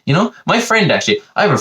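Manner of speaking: high-pitched mock amazement; impressed American. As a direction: play an easily impressed American. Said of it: "they'll be like".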